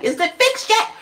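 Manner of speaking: high, laughing, exasperated squeal at the bank; laughing outraged high-pitched rant; pissed-off squeal. Retold or imitated. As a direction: shriek it through a laugh, fed up. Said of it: "I was pissed".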